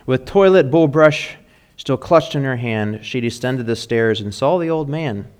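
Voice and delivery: lively storytelling read of a rhyming poem. A narrator's reading. Pastor reads a funny poem to the mothers, playful and rhythmic. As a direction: read the holiday poem to the congregation with a bounce.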